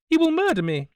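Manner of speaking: shrill high scream of terror; terrified shriek. A narrator's reading. Woman shrieking that the Italian will kill her, high and loud. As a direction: scream it as if the gun is already pointed at you. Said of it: "she shrieked".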